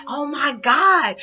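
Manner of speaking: slow, drawn-out, high and loud oh my God; starstruck gasp. Retold or imitated. First seeing Jussie Smollett, drawn-out high excited exclamation. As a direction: stretch it out, dazzled. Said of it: "And I'm like".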